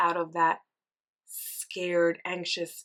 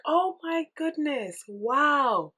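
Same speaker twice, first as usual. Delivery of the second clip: higher, amazed and elated; thrilled; 8 semitones higher, 6 dB louder, slower than usual. Retold or imitated. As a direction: sound giddy on the ride, voice lifted high. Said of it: "and you're like".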